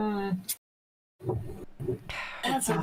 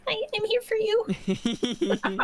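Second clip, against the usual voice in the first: cute chips voice; 10 semitones higher, 3 dB quieter, slower than usual. Pretend.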